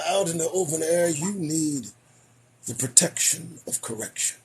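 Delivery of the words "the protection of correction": ordinary talk, nothing marked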